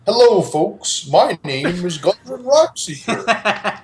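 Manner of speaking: slow, sing-song theme park welcome; theme park character. Acted. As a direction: greet guests like a cheesy ride host. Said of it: "You sound like a terrible theme park character".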